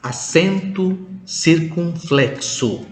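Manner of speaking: acento circunflexo, slow and carefully pronounced; Portuguese term. Shown as a demonstration. Teacher names the Portuguese accent mark slowly. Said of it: "Aksen tertutup disebut dengan".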